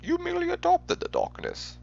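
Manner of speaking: muffled, put-on villain voice, swooping in pitch; Bane impersonation. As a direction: do a hushed, theatrical Bane impression. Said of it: "That's my best impersonation".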